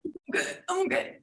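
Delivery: mock denial. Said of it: "the guy's bleeding and he's like".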